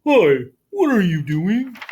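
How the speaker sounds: lightly voiced whale saying hi; a curious whale greeting Floyd.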